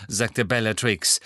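ordinary delivery

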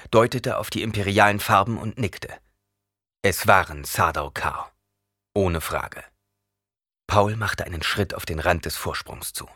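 ordinary delivery

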